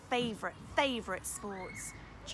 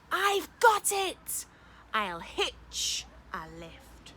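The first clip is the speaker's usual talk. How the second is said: excited cry; 5 semitones higher, 7 dB louder, much slower than usual. Acted. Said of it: "then cried".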